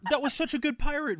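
high-pitched mock-offended protest; indignant protest. Real, not acted. Co-host defending his pirate impression, high indignant protest.

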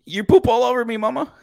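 put-on high, startled parent voice to the baby; exasperated mom to baby. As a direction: react as a mom caught off guard by a diaper. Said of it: "there's sometimes I just say, like".

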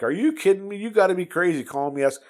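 retold irate pilot, pitched high and quick; mock outrage at a silly phone request. Squadron guy on the phone scoffing at a pizza request, mock irate. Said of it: "he sounded a little irate".

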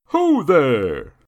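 loud, high hail from a distance; long carrying shout; calling out. Acted. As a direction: holler to halt a fight. Man calls out to stop fight.

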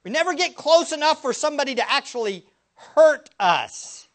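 full-force preaching. Acted. Preacher pressing the congregation with rising intensity. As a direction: hammer the question home, building.

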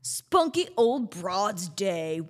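ordinary talk

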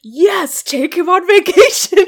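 loud, high cheer breaking into laughter; excited cheer. Retold or imitated. Viewer re-enacting delight at the vacation idea, excited. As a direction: cheer it on at full volume, laughing. Reenacts own excited note on scene.

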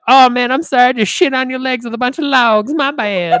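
loud, hammed-up fake sorry; mock apology. Boy mock-apologizing to church kids, loud and cheeky.

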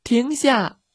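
high, loud command to halt; shouting. A narrator's reading. Girl ordering the runaway dog, shouting. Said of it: "茉莉大喊".

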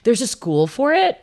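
stunned, as if the idea never occurred to you; shocked disbelief. Retold or imitated. Young woman retelling her shock at culinary schools, amazed disbelief.

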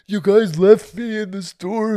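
high, slow, loud mock wail; hammed-up bawling of an upset kid; imitated child crying. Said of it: "he just starts crying".

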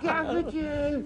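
high, slow, stretched-out put-on girl voice; mock daughter's voice. The daughter reacting to her dad's turducken, in a high slow mock voice. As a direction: drawl it out like a dazed daughter. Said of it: "was like".

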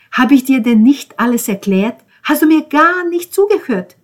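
bitter tirade building to high, loud questions; escalating indignant rant; worked-up reproach. Acted. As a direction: start reproachful and climb into an outburst. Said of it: "Ben regte sich wieder einmal auf".

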